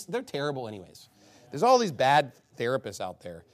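ordinary talk

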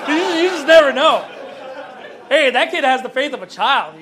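loud laughing aside; chuckling preacher, amused at his son. Real, not acted. Laughing at his own story.

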